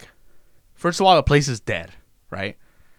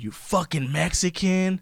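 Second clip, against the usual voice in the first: slow, higher sneer imitating a rude customer; mocking customer slur; 7 semitones higher, 5 dB quieter, slower than usual. Retelling a customer's insult, slow higher sneer. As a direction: spit it out like the hostile customer did. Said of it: "he told me that".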